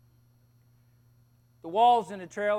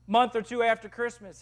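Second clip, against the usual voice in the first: usual speaking voice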